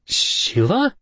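"Sheila?" squeaked very high with a rising tune. A narrator's reading. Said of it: "I squeaked".

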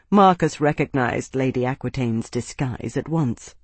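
plain reading voice

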